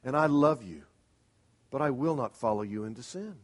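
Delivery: soft, tender but firm refusal; wife to husband, softly and lovingly. Shown as a demonstration. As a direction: say it gently to the husband, holding the line. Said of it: "then very lovingly say".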